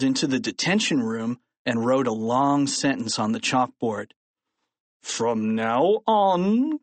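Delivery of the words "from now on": slow, higher-pitched menacing growl. A narrator's reading. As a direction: growl it out slowly as the angry principal. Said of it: "growled Mister Krupp".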